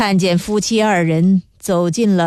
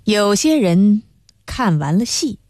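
ordinary delivery, nothing marked

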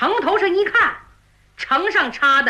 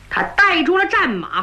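ordinary delivery, nothing marked

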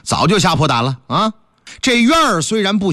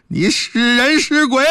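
nervous challenge; 6 semitones higher than usual. Acted. Constable asks ghost, frightened.

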